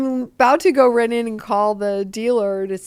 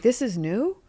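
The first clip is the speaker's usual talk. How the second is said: put-on a light Austrian-accented doubt; lightly imitating an Austrian accent, skeptical; Arnold Schwarzenegger accent; 4 semitones higher than usual. Imitating Arnold Schwarzenegger doubting her new car, light Austrian accent. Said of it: "I'm not doing his accent right".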